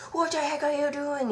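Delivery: a hint of an angry father bursting in; angry dad.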